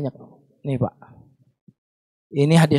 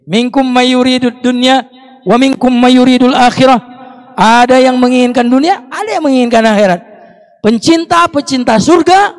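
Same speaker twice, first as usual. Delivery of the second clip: fiery sermon delivery; preaching at full force; 5 semitones higher, 10 dB louder than usual. Acted. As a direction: proclaim it to the hall. Preaching a verse loudly.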